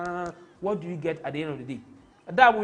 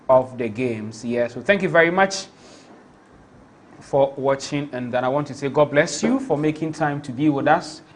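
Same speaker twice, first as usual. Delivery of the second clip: loud, slowed sign-off thanking the viewers; loud stream outro; 4 semitones lower, 10 dB louder than usual. Acted. Thanking viewers at the end of the stream, a loud announcer voice.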